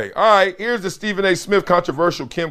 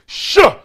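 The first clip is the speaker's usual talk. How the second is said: sharp high shouted "shuh"; hype yelp; 13 semitones higher, 6 dB louder than usual. Acted. Hype chant reading comment.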